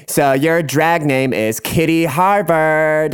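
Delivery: high, slow, hammed-up proclamation; drag hosts' announcement. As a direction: draw out the new stage name grandly. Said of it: "They're like".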